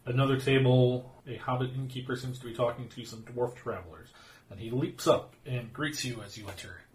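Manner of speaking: warm descriptive scene setting; storytelling narration. A narrator's reading. Game master scene narration.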